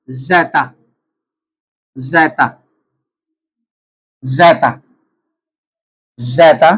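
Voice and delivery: teacher drills 'ज़ेटा', each 'ज़ेटा' on its own; Swedish word. Shown as a demonstration. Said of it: "इसकी आवाज है जे की तरह".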